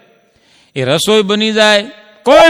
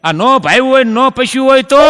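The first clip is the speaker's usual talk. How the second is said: higher and louder, a whiny hammed-up husband; mock suspicious husband; 7 semitones higher, 3 dB louder than usual. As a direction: quiz her about the food, rising in protest. Husband suspicious of wife's dish. (શંકાશીલ પતિ, ઊંચા અવાજે ફરિયાદ)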